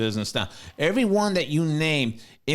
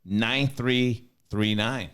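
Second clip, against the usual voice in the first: careful slow phone number; slow, deliberate number read; slower than usual. Shown as a demonstration. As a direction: spell out each digit slowly. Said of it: "Can you say that one more time?".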